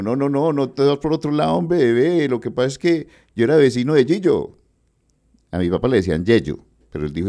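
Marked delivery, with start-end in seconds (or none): 0.0-4.6 put-on high, affectionate old man's voice; imitating an old musician. Percussionist tells young host he wants to hold him, high fond voice. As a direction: play the old percussionist fondly teasing.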